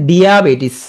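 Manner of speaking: diabetes as "dee-aa-bay-tis"; spelling pronunciation. Shown as a demonstration. Teacher voicing the spelling, drawn-out dee-aa in diabetes. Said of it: "আমি উচ্চারণ করে লেখো".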